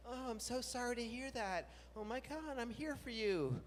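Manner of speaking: overdone condolence; mock sympathy. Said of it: "So you're like".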